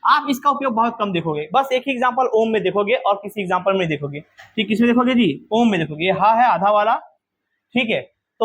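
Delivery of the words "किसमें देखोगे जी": normal talking voice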